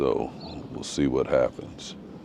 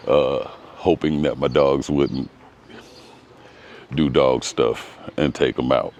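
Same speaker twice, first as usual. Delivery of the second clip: normal talking voice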